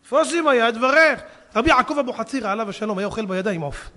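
normal talking voice

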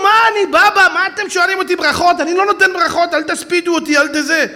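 high, loud mock protest; humble rabbi's protest. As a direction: play the modest sage waving off praise. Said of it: "והוא משחק אותה כאילו". (מחאה צנועה, גבוהה ורועשת)

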